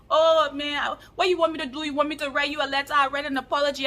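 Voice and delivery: put-on Nigerian accent. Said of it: "do my best Nigerian accent".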